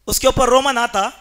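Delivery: usual speaking voice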